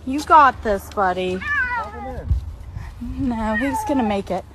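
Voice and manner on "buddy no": ordinary talk, nothing marked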